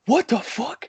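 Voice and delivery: high-pitched mock yell; retold yell, pitched very high without much volume; Jeff Jarrett. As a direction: imitate someone yelling into the night, voice way up. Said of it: "Jeff Jarrett just yells".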